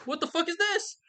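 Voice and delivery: a prince stunned at the bodies; high-pitched disbelieving yelp; shocked prince. Retold or imitated. Parents arrive at the scene.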